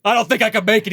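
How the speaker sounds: high, loud mock panic; acted desperate plea; freaking out. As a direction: imitate someone close to cracking. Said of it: "freaking out".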